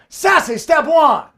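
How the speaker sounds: slow, high, loud sassy announcement. Pretend. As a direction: flaunt each step with hammed-up sass. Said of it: "The sassy way, just for all of you".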